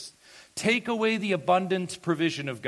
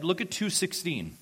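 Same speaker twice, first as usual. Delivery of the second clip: ordinary talk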